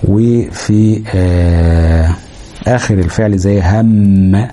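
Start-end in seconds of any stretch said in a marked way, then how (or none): 3.6-4.5 هم with a long nasal hum on م; held ghunna. Shown as a demonstration. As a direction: hold the doubled م in هم. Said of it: "أو على الميم المشددة". (هم بغنة ممدودة على الميم)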